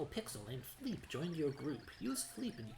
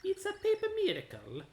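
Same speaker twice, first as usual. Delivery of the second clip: much higher, louder overjoyed Italian; giddy Italian pixel, high and loud; Italian accent; 10 semitones higher, 5 dB louder than usual. Acted. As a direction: burst out as a delighted Italian lover. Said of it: "It has an Italian accent".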